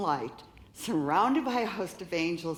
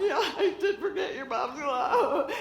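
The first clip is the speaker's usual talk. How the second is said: laughing; 9 semitones higher than usual. Retold or imitated. Answering daughter, laughing.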